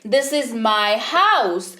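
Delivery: this is my house, each word drawn out very slowly; very slow pronunciation. Shown as a demonstration. Teacher carefully modelling English, very slow. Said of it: "आपको उच्चारण वाले शब्द पर ध्यान देना है".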